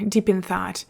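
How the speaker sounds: ordinary delivery, nothing marked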